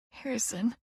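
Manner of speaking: soft, tearful whimper of his name; barely voicing his name on a tearful whimper. A narrator's reading. Sister whimpering her twin's name, softly and tearfully. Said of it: "I whimper".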